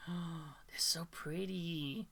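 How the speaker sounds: slow breathy whisper; awed whisper. Real, not acted. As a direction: whisper it slowly in awe. Gasping at cute cats.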